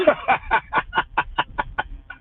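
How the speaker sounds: high airy laugh, mostly breath; soft high giggle; breathy laugh. Real, not acted. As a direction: laugh under the breath, amused. Laughing at own remark.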